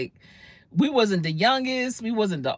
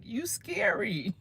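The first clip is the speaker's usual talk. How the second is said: soft, slow, small scared voice; lightly acted teary whisper; tearful hushed reply; 9 semitones higher, 7 dB quieter, slower than usual. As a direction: say it like a frightened girl near tears. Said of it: "And she started crying".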